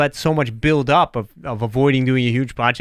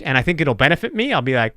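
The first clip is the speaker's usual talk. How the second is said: usual speaking voice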